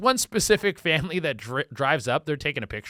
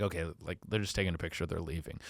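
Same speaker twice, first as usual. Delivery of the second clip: much softer, low and calm; calm shrug; 10 semitones lower, 11 dB quieter, faster than usual. Retold or imitated. Homeowner watching through the blinds, soft and calm. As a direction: let it go with a quiet sigh. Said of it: "and you go like".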